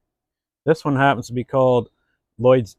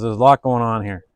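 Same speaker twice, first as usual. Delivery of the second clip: usual speaking voice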